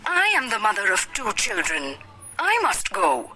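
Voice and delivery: usual speaking voice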